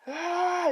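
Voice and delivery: very high short cry of pain; pained yelp. Acted. Kid crying out when something falls on him. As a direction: yelp as something crashes onto you. Kid hit by falling object.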